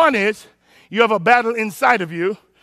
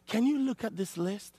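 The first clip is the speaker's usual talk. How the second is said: much softer, worried ask; a soft, deflated request; hushed question; 14 dB quieter than usual. Retold or imitated. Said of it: "I said".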